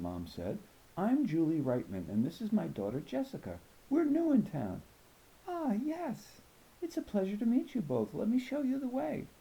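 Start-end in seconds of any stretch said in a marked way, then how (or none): 1.0-4.8 higher, bright polite mother introducing her daughter; a mom making a good first impression; mother greeting the principal politely; friendly mother. 5.5-9.3 higher, formal and gracious principal; a principal putting newcomers at ease; welcoming principal. Acted. Principal welcomes new family.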